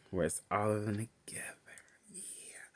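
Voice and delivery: hushed gossipy whisper. Real, not acted. Telling viewers who is dating, in a whisper. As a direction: whisper it like a secret.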